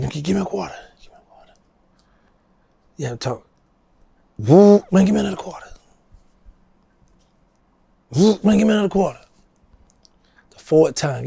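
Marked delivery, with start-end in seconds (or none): 0.0-1.5 breathy whispered begging; hushed plea for change. Retold or imitated. Whispered plea for quarter in story. 4.4-9.2 mock eager begging. Retold begging friend for quarters.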